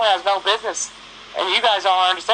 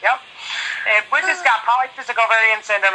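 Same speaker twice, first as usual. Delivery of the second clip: normal talking voice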